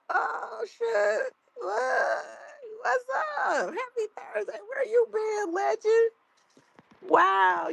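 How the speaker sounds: greeting a returning chat regular with high excitement; excited squeal. Real, not acted. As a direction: squeal a welcome to a long-lost friend. Squealing welcome to returning viewer Legend.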